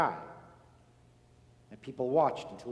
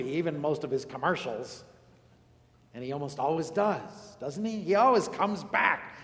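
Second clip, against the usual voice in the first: usual speaking voice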